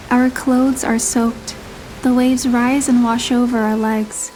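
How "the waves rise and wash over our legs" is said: plain reading voice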